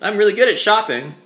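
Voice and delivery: imitating a host's unnatural chatty delivery; contrived TV banter. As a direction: gush with fake on-air enthusiasm. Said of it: "unnatural conversations".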